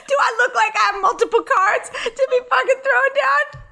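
squeaky, laughing indignation; hammed-up affronted wail; mock outrage. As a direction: protest very high, on the edge of giggles. Declined-card bit, outraged.

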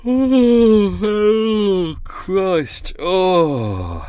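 loud, high strained oh, oh, Christ; effortful groans. Real, not acted. Vlogger straining as he climbs aboard, loud strained groan. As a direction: heave it out like lifting something heavy.

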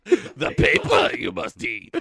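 ordinary delivery

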